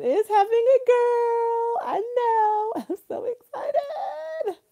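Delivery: high, slow squeal breaking into a laughing sob; so happy it tips into tears; tearful excitement. Real, not acted. Announces friend's baby news.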